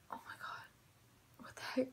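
breathless frightened whisper; scared whisper. Retold or imitated. Goody-goody whispering to friends, scared to sneak out. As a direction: hush it like you might get caught.

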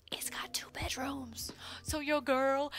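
softly whispered secret. Real, not acted. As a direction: whisper it like exciting news. Sharing a secret about apartment.

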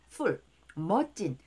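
usual speaking voice

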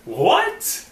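very high, rising "What"; baffled disbelief. Real, not acted. Player left baffled by the ending, disbelieving. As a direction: squeak out the question.

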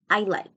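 "I like" with d almost silent after "I"; reduced I'd, sounding as "I like"; barely heard D. Shown as a demonstration. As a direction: swallow the d between "I" and "like". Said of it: "esa D casi no suena".

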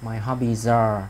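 slow, "hobbies" linked into "are"; slow demo of linking "hobbies are" for a student; linked sounds. Shown as a demonstration. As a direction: glide the z of "hobbies" straight into "are", slowly. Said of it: "nối sang, nối sang này".

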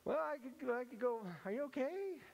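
high, hesitant attempt at consoling; uncomfortable. Uneasy giver made to comfort the cake maker, uncomfortable. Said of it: "totally uncomfortable".

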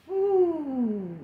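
blowing out a tired whew; relieved exhale after effort. Real, not acted. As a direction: exhale hard after a set. Exhale after finishing the set.

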